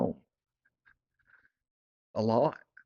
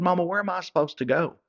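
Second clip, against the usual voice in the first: louder, higher plea to Mama; pleading; 6 semitones higher, 4 dB louder than usual. Retold or imitated. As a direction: beg her where to go, voice up.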